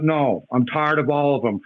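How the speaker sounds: ordinary talk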